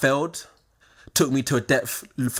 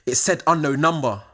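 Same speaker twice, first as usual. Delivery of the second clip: normal talking voice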